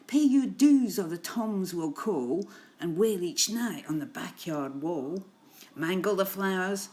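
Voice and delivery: loud, emphatic gangster cat threat; snarling boss cat. Acted. Boss cat demanding protection money at the door, snarling. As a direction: lay down the law as the feline boss. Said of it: "The boss cat snarls".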